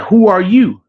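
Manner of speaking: high-pitched mock-hostile challenge; nasty retort. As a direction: act out a rude comeback, pitched right up. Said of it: "like, nasty with him".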